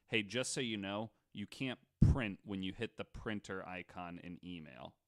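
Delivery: ordinary talk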